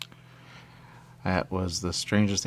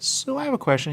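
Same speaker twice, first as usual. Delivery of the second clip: squeaky put-on voice. Playing a character, squeaky voice.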